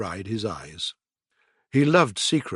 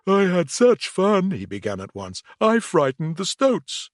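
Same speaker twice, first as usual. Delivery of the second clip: higher, gleeful mole voice; delighted Mole; 6 semitones higher, 3 dB louder than usual. A narrator's reading. Mole bragging to friends, higher and eager. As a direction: bubble over with the fun. Mole's voice.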